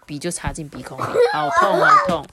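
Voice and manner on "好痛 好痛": high, loud, hammed-up cry of pain; mock pain. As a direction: play it up like a kid who got hurt. Acting out pencil up nose. (夸张地假装喊痛)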